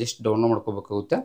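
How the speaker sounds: usual speaking voice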